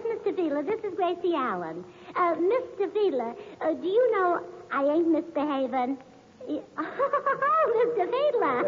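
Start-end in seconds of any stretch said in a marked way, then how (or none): 6.5-8.7 high, giggly laughter through the words; flustered giggle on the phone; giggling. Real, not acted. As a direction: giggle at his flirty remark. Giggling at caller's flirting.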